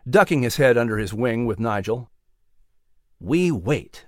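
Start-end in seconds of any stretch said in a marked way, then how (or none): 3.2-4.0 low quiet mouse voice; mouse answering. Acted. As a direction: answer with calm patience. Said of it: "Nigel answered".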